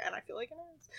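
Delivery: tiny, hushed retold thought; small embarrassed aside. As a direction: shrink it to a sheepish squeak.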